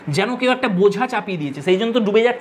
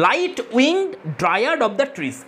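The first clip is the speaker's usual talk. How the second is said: slow poem reading; 2 semitones higher, much slower than usual. Shown as a demonstration. Teacher recites poem line.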